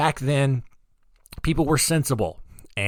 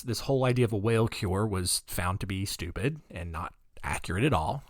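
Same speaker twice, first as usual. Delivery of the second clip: ordinary talk, nothing marked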